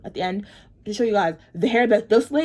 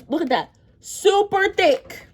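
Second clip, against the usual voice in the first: amazed showing off; 10 semitones higher, slower than usual. Real, not acted. Showing beads to camera.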